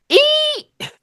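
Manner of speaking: very high, loud squeaky eat; loud printer squeal. Retold or imitated. Cook mimicking the order printer, a loud high squeal. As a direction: shriek it like a machine alarm.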